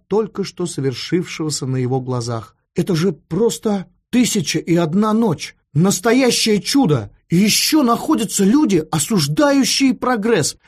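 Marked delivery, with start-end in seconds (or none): 2.8-10.6 high, enthusiastic marvelling; amazed excitement. A narrator's reading. Young man amazed by the telephone, high excited praise. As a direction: marvel aloud as if at a miracle. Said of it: "находился под впечатлением волшебства".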